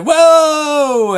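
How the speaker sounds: long, high, loud whoa; hollering. Retold or imitated. Father flying past kid on skis, hollering. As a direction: yell it like you're careening out of control.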